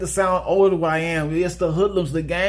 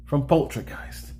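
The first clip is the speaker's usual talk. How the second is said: ordinary talk, nothing marked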